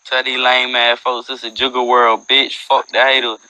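ordinary talk, nothing marked

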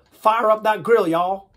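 loud, high Southern US accent. Shown as a demonstration. Accent warm-up for the group, loud Southern accent. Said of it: "let's do accent warm-ups".

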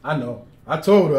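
usual speaking voice

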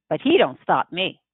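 ordinary delivery

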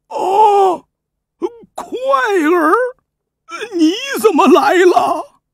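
put-on an old grandfather overcome at the sight of his grandson; tearful old king. The king, weeping, calls to his grandson, high and loud.

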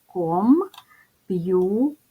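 Комп'ютер slowly split into syllables, ком-п'ю-тер; divided into syllables. Shown as a demonstration. Teacher splits the word into syllables for the class, slowly. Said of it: "Ділимо на склади".

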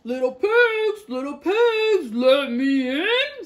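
loud, slow menacing wolf call; wolf calling at the straw house, loud and slow; big bad wolf. A narrator's reading. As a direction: boom it out like a hungry wolf. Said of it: "said the wolf".

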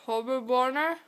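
barely able to say it, mouth packed; mumbled through eleven marshmallows; cheeks stuffed full. Real, not acted.

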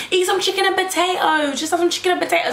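high, sing-song mocking of the friend. Friend's repeated advice voiced.

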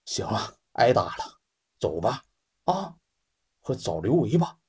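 whispered, very soft and low; injured man murmuring to his brother; weak and beaten. Acted. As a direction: breathe it out, too beaten to speak up. Beaten men resign themselves.

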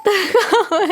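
slow, high whining wail; mock wailing lament. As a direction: drag it out like a fake sob. Lamenting own drinking mission.

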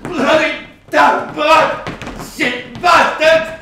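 high, loud defiant cursing; furious shouting. Acted. Boy locked in the cupboard raging at the darkness. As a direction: rage and curse through the door.

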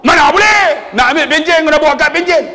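high, loud scolding shout; irate official at full volume; angry clerk. Retold or imitated. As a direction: yell the rule at an old man. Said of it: "Cik marah pakcik ni".

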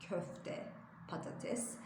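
ordinary talk, nothing marked

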